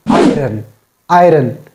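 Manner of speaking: "iron" with the r sounded; "iron" with an r before the second vowel; mispronounced iron. Shown as a demonstration. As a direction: say "iron" as eye-ren. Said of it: "It is not iron".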